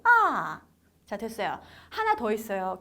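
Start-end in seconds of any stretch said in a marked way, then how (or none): 0.0-0.6 loud 아 falling steeply from high; fourth tone. Shown as a demonstration. Teacher showing fourth tone, 아 sharply falling. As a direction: start 아 high and let it slide right down. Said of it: "미끄럼".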